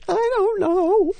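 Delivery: very high, slow, protesting squeak; mock innocence. Actress retelling her answer to the director, mock-innocent. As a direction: plead ignorance in a drawn-out high voice.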